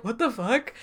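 deadpan disbelief. Retold or imitated. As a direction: mutter it in quiet disbelief. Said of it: "And Michael's like".

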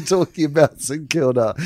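ordinary talk

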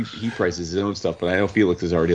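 usual speaking voice

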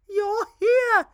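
high, far-off royal voice; queen voice. Acted. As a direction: speak up as the excited queen. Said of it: "the queen said".

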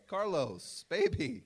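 high-pitched mock plea to a star; coaxing music producers. Producers correcting [name]'s emphasis in the studio, high mock-coaxing voice. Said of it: "and they were like".

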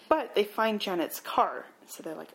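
ordinary delivery

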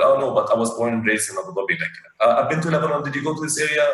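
normal talking voice

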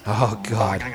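normal talking voice

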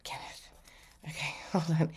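hushed whisper under her breath; breathy aside to herself. Real, not acted. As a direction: whisper the name to yourself, then hold on. Medium repeating the name to herself.

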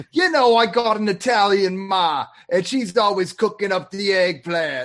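hammed-up stand-up bit about an Italian mother; Sebastian Maniscalco. Podcaster mocks a San Diego comic bragging of his Italian ma, hammy impersonation. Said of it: "That dude's from fucking San Diego, and he's like".

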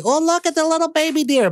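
high, cooing put-on voice; mock gushing. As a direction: imitate someone cooing over a baby animal. Imitates gushing over baby deer pictures.

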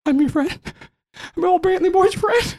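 high, squeaky bid for friendship; Brantley, eager. Acted. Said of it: "then he immediately goes".

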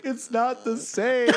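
high, drawn-out tearful dad imitation; hammed-up wail, dragging the words; mock crying. Dad to son who works with him, mock crying. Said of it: "then they're crying as I leave".

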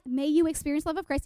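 verse rattled off fast; freaking out. Retold or imitated. Giddy teen self rechecks the verse, rapid recital. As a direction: race through it like skimming a checklist. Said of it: "And I'm freaking out".

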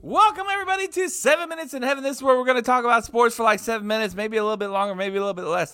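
fast, high hype announcer; announcer intro. Acted. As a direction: open the show like a TV host. Opens the segment as a host.

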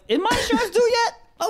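high-pitched put-on question; mock phone query. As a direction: pipe it up high, as a bit. Acting out a bored phone call.

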